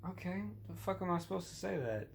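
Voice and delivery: high, incredulous squeak of confusion; baffled inner reply. Retold or imitated. Comic thinking about his dad's goofy text, baffled and high. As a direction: pitch it up, lost for how to answer.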